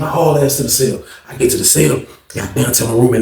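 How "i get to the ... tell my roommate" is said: ordinary delivery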